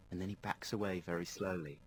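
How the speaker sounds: ordinary delivery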